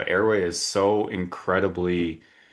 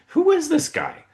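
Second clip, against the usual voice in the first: much higher and louder, incredulous; baffled wonder; 7 dB louder than usual. Retold or imitated. Host retelling his amazement at the mystery guest, incredulous. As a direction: ask it in sheer disbelief. Doctors crowd an unknown guest.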